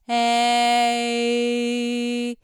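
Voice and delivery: えー held, gliding into い with the e shape; e moving to i. Shown as a demonstration. Voice teacher gliding from e to i. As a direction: keep the e mouth into i. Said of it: "そのままいに行きます".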